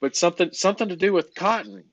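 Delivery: high, loud mock innocent hint; teasing. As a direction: tease him about cotton. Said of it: "I'm kidding".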